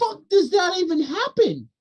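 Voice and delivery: high-pitched baffled outburst; exasperated disbelief. Real, not acted. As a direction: blurt it out in real disbelief.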